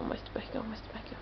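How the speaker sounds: mostra o barrigão fading to a whisper. Real, not acted. Mother repeating to baby.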